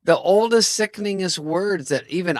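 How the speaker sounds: high, loud hammed-up rant; exasperated scolding. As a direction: berate your buddy in mock despair. Retold scolding of old-sounding friend.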